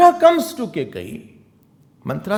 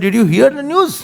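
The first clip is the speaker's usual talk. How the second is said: high, louder eager question; eager gossip; 7 semitones higher, 7 dB louder than usual. Acted. Manthara rushes the news to Kekai, eager and loud. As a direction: lean in with juicy news.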